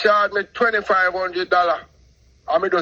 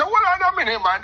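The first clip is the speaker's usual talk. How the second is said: alarmed objection; 10 semitones higher, faster than usual. Retold or imitated. Gambling wife, he objects.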